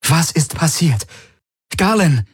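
high-pitched, urgent worried demand. A narrator's reading. Ylana questions the caller about what happened, demanding and worried. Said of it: "Ihre Stimme war fordernd und besorgt".

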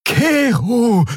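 high, urgent call of the name; alarmed cry. A narrator's reading. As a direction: cry out to stop the attacker. Friend cries out to stop the attacker. (beschwörender Ausruf)

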